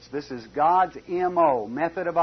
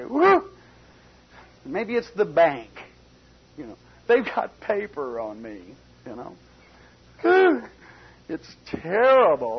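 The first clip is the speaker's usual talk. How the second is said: a hammed-up fretting debtor; mock worried believer; 2 semitones higher, 4 dB louder than usual. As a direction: play the worrier who fears the bank. Worried believer voiced, mock lament.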